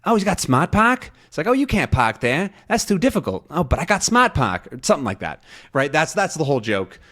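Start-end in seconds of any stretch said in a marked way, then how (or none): 0.0-1.1 high-pitched mock Boston neighbor; exaggerated Boston accent, pitched way up, r's dropped. Neighbors remarking on a car ad's feature, Boston accent. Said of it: "a bunch of neighbors saying". 1.5-4.7 high, hammed-up Boston neighbors; Boston accent. Neighbors arguing about parking in an ad, Boston accent. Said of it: "a bunch of neighbors saying".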